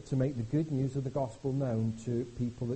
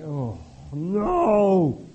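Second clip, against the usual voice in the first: mock dismay; 10 dB louder than usual. Mock despair at tax form.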